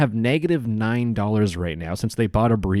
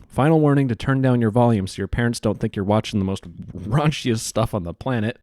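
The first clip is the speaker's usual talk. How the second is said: usual speaking voice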